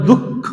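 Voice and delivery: দুঃখ said "duk-kho" with a double kh; geminated kh in দুঃখ, visarga unbreathed; doubled consonant. Shown as a demonstration. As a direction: stop on the k of দুঃখ, then release "kho". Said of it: "দুবার উচ্চারণ হচ্ছে".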